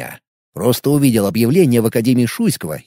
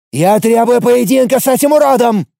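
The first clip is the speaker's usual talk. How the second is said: demanding yell; 8 semitones higher, 3 dB louder than usual. Acted. Youth demands duel, yelling.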